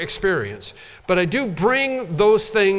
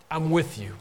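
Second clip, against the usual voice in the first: ordinary delivery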